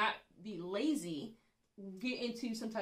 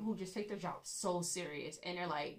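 usual speaking voice

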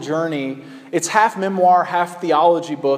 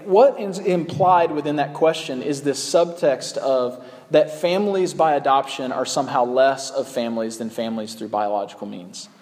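usual speaking voice

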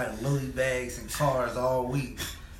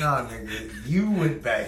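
laughing; 4 dB louder, slower than usual. Real, not acted. Laughing, blaming the other.

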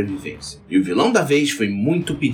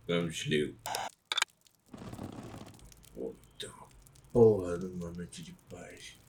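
low grumbling; 6 semitones lower, 15 dB quieter than usual. Acted. Sheriff grumbles, heading out.

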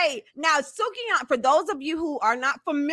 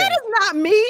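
surprised; 10 semitones higher, 4 dB louder than usual. Real, not acted. Denying AI image.

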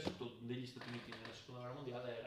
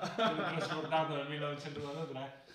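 laughing through words; 3 semitones higher, 8 dB louder than usual. Real, not acted. Laughing at the joke about Elia. (ridendo mentre parla)

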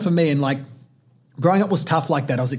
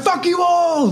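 high, loud retold retort; defiant kid; 3 dB louder, slower than usual. Boy riding off, yelling back, high and loud. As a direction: hurl it back at the whole family. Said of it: "I'm like".

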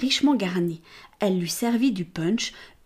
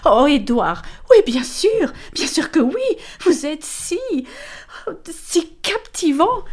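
higher, louder, cooing flirt; flirtatious lady; 8 semitones higher, 5 dB louder, slower than usual. Acted. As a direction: lure him with sugary charm. Amelia reassures Callaghan, flattering. (dame charmeuse, aiguë et roucoulante)

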